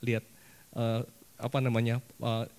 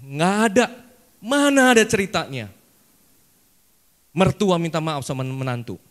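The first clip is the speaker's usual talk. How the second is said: louder, higher mother refusing outright; indignant mother; 3 semitones higher, 9 dB louder than usual. Retold or imitated. Mother refusing to apologize to her daughter-in-law, loud indignant scoff.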